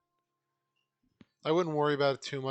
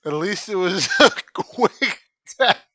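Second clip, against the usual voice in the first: slow and loud, laughing mid-sentence; laughing through words; 7 semitones higher, 14 dB louder, slower than usual. Real, not acted. Player joking about a quick death, laughing. Laughing after dying in game.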